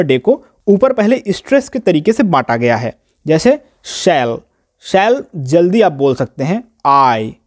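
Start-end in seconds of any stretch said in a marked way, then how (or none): 3.8-4.4 शैल said slowly and lightly, no stress; unstressed shall. Shown as a demonstration. Teacher showing students the unstressed syllable, slow and light. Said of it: "आराम से आप बोलते हैं, बहुत ही कम stress". 6.9-7.3 आई pushed from the throat, pitched lower; stressed I. Shown as a demonstration. Teacher showing students the stressed syllable, pressed from the throat. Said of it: "आई जब आप बोलते हैं तो आपको अपने गले से स्ट्रेस देना पड़ता है".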